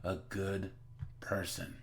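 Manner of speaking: low, soft, sober aside; subdued disapproval. Real, not acted. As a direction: drop low and quiet, trailing away. Sober aside after loud outburst.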